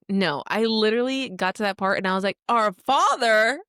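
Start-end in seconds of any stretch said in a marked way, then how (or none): 2.5-3.6 slow, high, louder questioning; stunned disbelief. Retold or imitated. Reader hitting the final twist, slow stunned question. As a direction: drag it out in disbelief. Reacting to book's last line.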